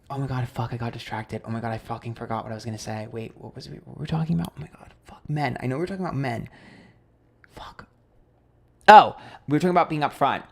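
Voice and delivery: very soft, under the breath; barely audible, distracted muttering; muttering to self. Real, not acted. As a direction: think aloud quietly while hunting for the lost thread. Lost thread, talking to self.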